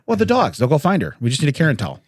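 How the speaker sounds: normal talking voice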